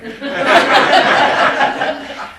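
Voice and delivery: a big, long burst of laughter; loud laugh. Real, not acted. Storyteller laughing about enrolling by phone, loud laugh. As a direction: let the laugh roll out loud.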